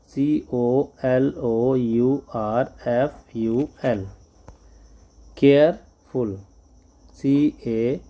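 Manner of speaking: letters spelled slowly one by one; letters dictated to class; slow spelling. Shown as a demonstration.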